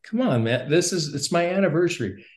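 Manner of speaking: mildly exasperated, rising up high at the end; frustrated protest. Retold or imitated. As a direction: push back like a fed-up young husband. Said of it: "I was kinda getting frustrated".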